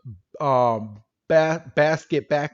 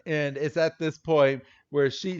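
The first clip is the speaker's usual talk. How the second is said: ordinary talk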